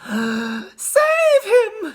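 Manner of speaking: panicked high scream; Missus Gloop. A narrator's reading. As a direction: scream for rescue, very high and loud. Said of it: "screamed Missus Gloop".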